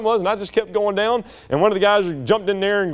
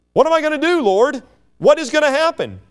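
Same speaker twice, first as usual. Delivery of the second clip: higher-pitched, acted plea to God; overwhelmed plea; 7 semitones higher than usual. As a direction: cry out as if in over your head. Voices an overwhelmed cry to God.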